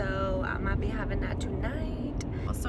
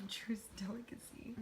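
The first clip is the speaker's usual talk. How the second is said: whispering; 13 dB quieter than usual. Real, not acted. Repeating the answer in a whisper.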